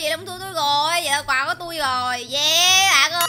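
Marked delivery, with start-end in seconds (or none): 2.3-3.2 triumphant cheer. Real, not acted. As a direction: cheer for your own win. Celebrates winning the prize.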